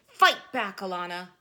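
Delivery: loud, fierce rallying cry; a sudden shout in boxing class. Real, not acted. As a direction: yell it at yourself to hit back. Urging herself at the punching bag.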